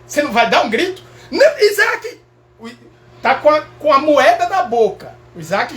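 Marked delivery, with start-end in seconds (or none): 1.3-2.2 put-on high, loud warning yell at a child; parent shout. Father yelling at Isaac near the socket, loud yell. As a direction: yell to stop the kid.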